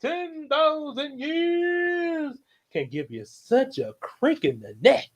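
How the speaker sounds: big booming entrance, high and slow; Robin Williams genie. Acted. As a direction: burst out like a genie freed. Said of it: "so genie voice there".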